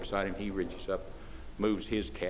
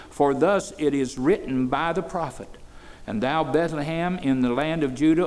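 scripture reading; 5 semitones higher, 4 dB louder than usual. Acted. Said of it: "I'll read five and six".

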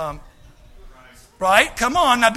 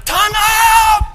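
high, loud shouted time out; mock shout of time out; 12 dB louder than usual. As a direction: yell to cut someone off. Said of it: "it's like".

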